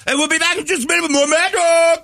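piercing, very high mock radio sign-off; Mad Dog. Radio host going to commercial, piercing high impression. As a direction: throw to break in a screechy high host voice. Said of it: "that is piercing".

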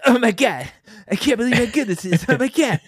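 imitating Jimmy Fallon. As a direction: gush over the game like Jimmy Fallon. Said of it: "Jimmy'd be like".

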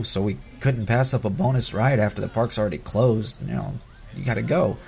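ordinary talk

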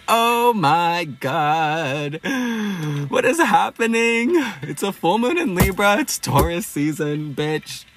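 high-pitched, drawn-out excited outburst; thrilled exclamation. Retold or imitated. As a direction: retell an overwhelmed inner squeal, stretching the words. Retells her thrilled inner reaction.

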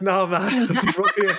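amused, imitated British accent. Telling a Brit they are local, mock British accent. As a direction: deny it in a put-on British accent, laughing. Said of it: "with a British accent".

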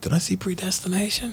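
a playful stage whisper, as if sharing a secret; joking whisper. Pretend. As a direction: lean in and whisper the question conspiratorially. Mock secret aside to friend.

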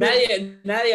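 high, loud burst on Nadie; a sudden emphatic cry; emphatic outburst. Real, not acted. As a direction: jump in hard to insist. Emphatic objection in debate.

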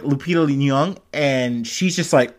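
ordinary talk

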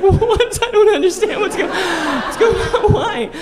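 very high, baffled dog voice through laughter; confused dog. Pretend. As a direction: squeak it out as the puzzled dog, laughing. Dog baffled by owner sobbing.

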